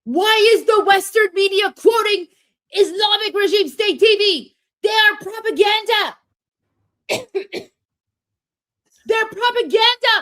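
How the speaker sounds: Armin rant impression. To the chat, imitating Armin's angry rant. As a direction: yell it out like a furious political pundit. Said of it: "I can do an Armin rant for you here".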